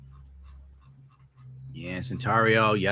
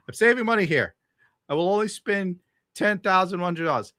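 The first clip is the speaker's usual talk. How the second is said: higher, mock-proud sarcasm; sarcastic; 7 semitones higher than usual. Real, not acted. Joking to chat about saving money, higher sarcastic. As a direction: pretend you're being thrifty.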